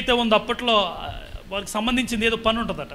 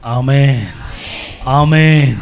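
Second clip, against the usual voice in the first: a louder ringing amen; amen raised to a near shout; 5 semitones lower, 9 dB louder, much slower than usual. Real, not acted. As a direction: call amen out to the crowd. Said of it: "కొంచెం గట్టిగా".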